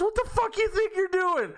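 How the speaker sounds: high-pitched angry woman impression; retold angry outburst. Woman catching a kid on her porch, high mock-angry yell. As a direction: imitate her outraged screech of a question. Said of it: "So she scared me".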